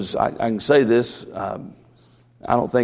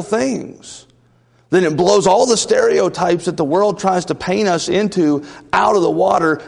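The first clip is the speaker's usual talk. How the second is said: preaching at full force; 7 semitones higher than usual. Acted. Pastor rousing the church about its children, fervent preaching. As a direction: build it up and drive it home to the congregation.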